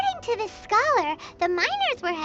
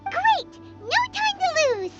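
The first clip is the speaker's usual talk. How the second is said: eager; 8 semitones higher, slower than usual. Acted. Rallying the party to set off.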